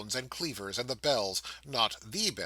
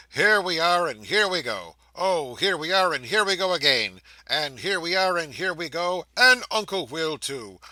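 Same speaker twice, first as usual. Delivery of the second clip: put-on higher and louder, bouncing a child up and down; sing-song glee as he swings her; playful romping; 4 semitones higher, 6 dB louder than usual.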